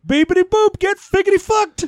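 high-pitched robot beeping turned into words; squeaky mock-droid cursing; R2-D2 impression. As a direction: chirp it out like a cheeky little droid. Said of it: "R two's like".

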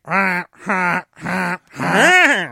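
stilted computer practicing laughter. Retold or imitated. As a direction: laugh like a machine learning how. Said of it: "it sounds like, you know, a computer".

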